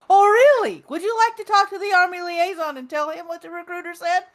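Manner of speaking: high, loud mocking oh really; sarcastic challenge. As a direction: call his bluff sharply. Officer lady calls his bluff.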